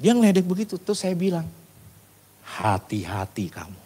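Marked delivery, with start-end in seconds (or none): 2.4-3.8 low, slow, softened caution; grave warning. Retold or imitated. Preacher warning the coworker who mocked God, grave warning. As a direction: warn him slowly and quietly.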